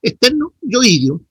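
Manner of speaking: teacher showing the stress; correct stress. Shown as a demonstration. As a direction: model Externo yoidio in two clear parts. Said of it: "la misma forma como pronuncian yoides".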